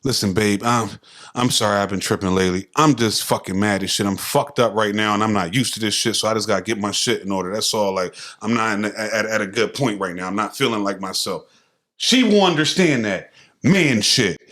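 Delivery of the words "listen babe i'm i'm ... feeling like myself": calm sincere talk. Shown as a demonstration. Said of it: "it's a different way".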